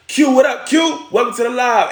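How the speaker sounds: high-pitched, hollered welcome; excited shout-out. Real, not acted. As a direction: hail a buddy joining the stream. Hailing a friend who joins.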